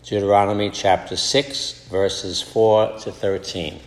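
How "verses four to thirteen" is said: normal talking voice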